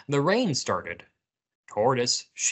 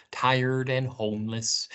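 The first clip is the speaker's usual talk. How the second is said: ordinary delivery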